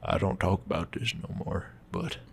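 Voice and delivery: a soft, lightly voiced tired old man; sad old man. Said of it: "as a sad old man".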